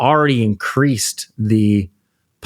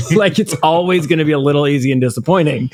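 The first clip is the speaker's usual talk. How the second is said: high, fast teasing laugh; 6 semitones higher, faster than usual. Real, not acted. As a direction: tease him while laughing.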